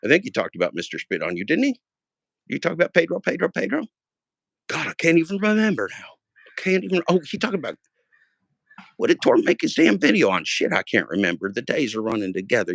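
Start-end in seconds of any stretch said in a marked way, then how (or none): 1.4-10.4 1 flustered searching. Real, not acted. Confused, searching memory.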